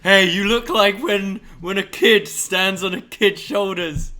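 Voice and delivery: loud slow taunt. Retold or imitated. Retelling his loud taunt at bar.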